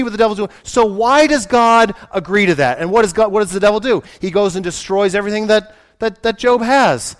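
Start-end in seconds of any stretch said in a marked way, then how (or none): none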